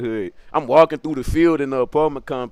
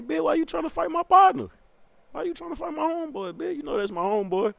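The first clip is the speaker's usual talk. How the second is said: high, hushed mock voice; confrontational cousin; 13 semitones higher, 6 dB quieter than usual. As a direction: act out the cousin's challenge in soft falsetto. Voices the cousin confronting him again.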